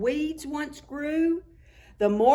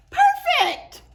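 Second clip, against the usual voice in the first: excited praise; 8 dB louder than usual. Acted. Praising a successful greeting.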